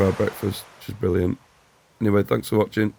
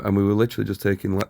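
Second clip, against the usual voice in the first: ordinary delivery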